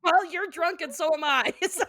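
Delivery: loud, high, through real laughter; laughing. Real, not acted. Tipsy host teasing drunk co-host, laughing hard. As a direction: crack up while saying it.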